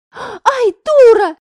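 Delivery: высокий громкий вскрик боли; вскрикни от пореза; крик от боли. A narrator's reading. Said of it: "выкрикнула одноклассница". (Семакина вскрикивает, порезавшись)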